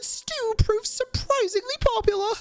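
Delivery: high, theatrical headline reader; excitable reporter character. Acted. Reporter showing off a gem of a headline, high and theatrical. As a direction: read out a juicy find in a high, lively character voice. Voices Charlotte in game.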